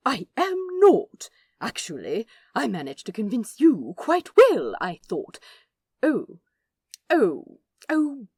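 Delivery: high, loud huffy protest into dawning alarm; prim angel bristling, then oh-oh; indignant angel. Acted. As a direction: protest proudly, then realise the slip. Aziraphale indignant then caught out.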